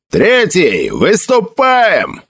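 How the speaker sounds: high, drawn-out marching cry; yelled command. A narrator's reading. Emil sets the Third battalion marching, yelling. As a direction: stretch the last word for the ranks. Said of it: "завопил Эмиль".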